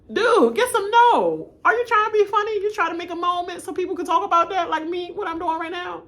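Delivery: high-pitched, loud incredulous scolding; squawking playful outrage; mock exasperation. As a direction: call him out in a high, worked-up voice. Mock scolding of misspelling player.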